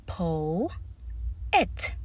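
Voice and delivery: "Po-et" with a long, stressed O, then "et"; emphasis on O. Shown as a demonstration. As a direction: draw out the O of "Po-et", pause before "et". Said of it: "I am putting emphasis".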